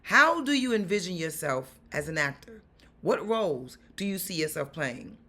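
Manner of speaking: normal talking voice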